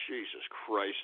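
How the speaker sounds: defeated grumble; weary mutter. Acted. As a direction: mutter it under your breath. Exasperated aside after sigh.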